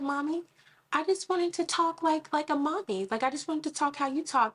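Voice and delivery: a hint of a small daughter explaining; child voice. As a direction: daughter explaining to her mom, mildly childlike. Daughter quoted by mother.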